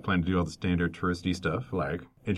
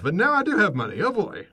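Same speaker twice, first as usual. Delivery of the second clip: higher and louder, gleeful about money; mock gleeful; 11 semitones higher, 5 dB louder than usual. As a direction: gloat like you just got rich. Gloating about having money.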